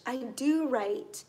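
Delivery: "do" stretched out and weighted; slow down and lean hard on "do"; stress on DO. Shown as a demonstration. Teacher gives another example of emphasis, "do" drawn out. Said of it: "this one adds emphasis".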